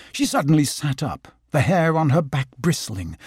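ordinary delivery, nothing marked

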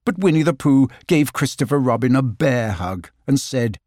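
usual speaking voice

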